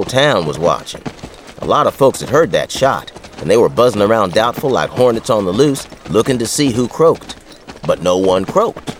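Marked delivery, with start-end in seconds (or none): none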